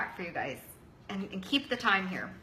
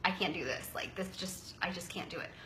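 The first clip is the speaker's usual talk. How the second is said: defeated; 3 semitones lower, 7 dB quieter than usual. Retold or imitated. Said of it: "call Christy and say".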